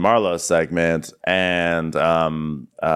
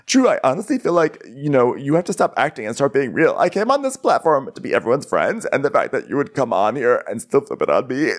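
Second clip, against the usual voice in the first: hammed-up hurt, pitched higher; soap opera melodrama; 5 semitones higher than usual. Sonya confronting Drew, mock soap opera hurt. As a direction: act wounded, rising to a high reproach. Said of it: "now comes the soap opera moment".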